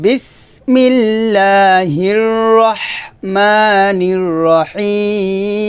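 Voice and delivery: slow chanted recitation; Quran recitation. Shown as a demonstration. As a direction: draw each word out in a recitation tune. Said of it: "আমার সাথে বলেন".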